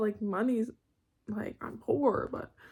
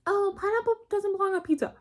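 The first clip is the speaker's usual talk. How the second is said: mocking people; 10 semitones higher, 3 dB louder than usual. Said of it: "That's when I hear people say".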